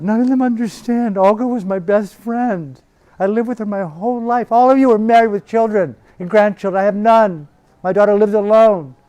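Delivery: very high, loud wailing lament; screaming in grief. Retold or imitated. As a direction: scream out the loneliness. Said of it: "Screaming out like that".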